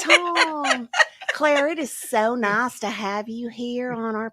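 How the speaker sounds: lightly put-on Tennessee drawl, slowed; Southern accent. Shown as a demonstration. Host showing off her Southern accent to co-host. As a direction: lay on a mild Southern accent, slow and sweet. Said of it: "Would you like to hear a little bit of it now".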